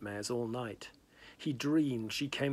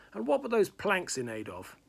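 louder, higher demand; irritated demand; 8 semitones higher, 5 dB louder than usual. Acted. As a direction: challenge him sharply. Hal demands an explanation.

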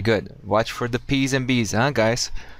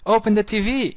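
much higher, mocking student voice; mimicking a student; 11 semitones higher than usual. Teacher mimicking a student's wrong request, much higher. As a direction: squeak it out like a student's error. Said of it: "We don't say that".

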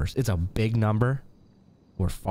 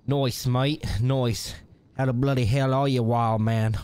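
amateur Australian accent, hammed up; matey greeting in a bad fake Australian accent; poor Australian accent; 3 semitones higher than usual. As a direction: put on a clumsy Aussie voice. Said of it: "poor attempt at an Australian accent".

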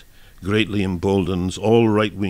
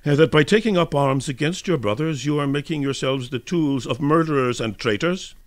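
higher-pitched contemptuous student challenge; insolent; 6 semitones higher than usual. A narrator's reading. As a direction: lecture them with open scorn. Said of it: "he said insolently".

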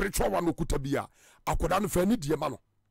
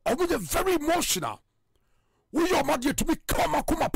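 "Omo dey very emotional" said noticeably higher, as a quoted line; quoted emphatically; 7 semitones higher than usual. Shown as a demonstration. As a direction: lift the pitch on "Omo dey very emotional" to set the quote apart. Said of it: "I just say".

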